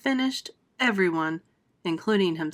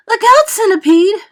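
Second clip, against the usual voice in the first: much higher, loud warning shout; alarmed cry; 13 semitones higher, 15 dB louder than usual. Acted. James warning the falling centipede, a loud alarmed shout. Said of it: "cried James".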